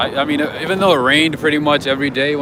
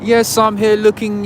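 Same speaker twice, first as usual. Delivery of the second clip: higher-pitched imitated British accent; mock British lady's accent, pitched up; 7 semitones higher, 3 dB louder than usual. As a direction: play the customer with a put-on British accent. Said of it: "with a British accent".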